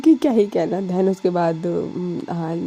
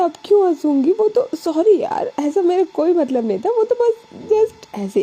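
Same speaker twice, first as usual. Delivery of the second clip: high voice, holding back giggles; suppressed laughter; 10 semitones higher than usual. A narrator's reading. Alisha apologising to Anu, fighting her giggles. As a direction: apologise while trying not to laugh. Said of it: "अभी भी हंसी आ रही है".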